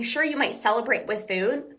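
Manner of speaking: usual speaking voice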